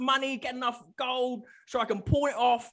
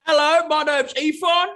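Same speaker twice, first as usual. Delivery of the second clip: loud character intro; 5 semitones higher, 9 dB louder than usual. Acted. Player introduces his character loudly.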